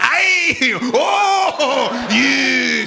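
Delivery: high, shouted Scottish vowel howls; exaggerated Scottish caricature, all stretched vowels; mock Scottish vowels. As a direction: yell drawn-out vowels like a cartoon Scot. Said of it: "just say vowels all the time".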